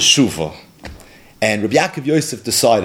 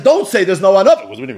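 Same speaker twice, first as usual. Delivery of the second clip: ordinary delivery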